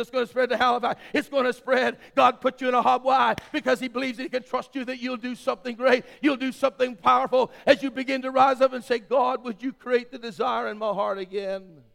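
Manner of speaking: loud, high, fervent preaching; full-force preaching. Acted.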